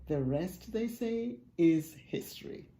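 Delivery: ordinary delivery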